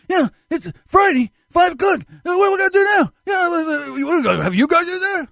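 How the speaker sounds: very high exasperated boss impression; Herb. Boss fumes at mechanics' Friday call, high-pitched impression. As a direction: imitate a worked-up boss, high and shouty. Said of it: "I haven't done that voice in a long, long time".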